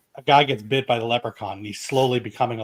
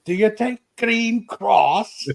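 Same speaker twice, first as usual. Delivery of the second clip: high, drawn-out mock Irish accent; bad Irish accent; 9 semitones higher, much slower than usual. Movie patient turning leprechaun answers doctors, bad Irish accent. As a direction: do a hammed-up, slow Irish lilt, pitched up. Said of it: "he just did this bad Irish accent".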